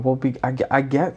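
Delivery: usual speaking voice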